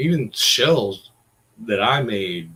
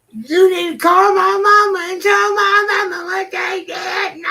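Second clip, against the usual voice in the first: much higher, loud whiny mock-crying shout; mocking her crying; 9 dB louder than usual. Mocking the roommate's phone tantrum, high whiny shout. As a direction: screech it like a sobbing grown woman throwing a tantrum. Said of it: "she was crying on the phone".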